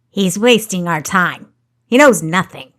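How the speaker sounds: loud, impatient outburst; angry snap. A narrator's reading. Baron cutting off the guard's questioning, angry snap. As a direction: snap dismissively and forcefully. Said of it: "Baron Hostile snapped".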